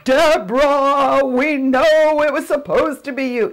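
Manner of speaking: high, loud, slow, grand mock voice of God; big mock movie God calling down; booming God voice. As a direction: ham up a movie God like Charlton Heston. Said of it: "I don't mean like Charlton Heston".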